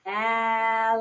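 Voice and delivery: "Alligator" stretched out syllable by syllable on a level pitch; very slow syllables. Shown as a demonstration. Teacher asking child to count syllables, "Alligator" very slowly drawn out. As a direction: draw "Alligator" right out, syllables still slightly joined. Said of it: "the drawn out syllables".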